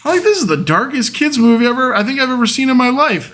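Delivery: high, fast disbelieving rant; incredulous. Retold or imitated. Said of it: "I was like".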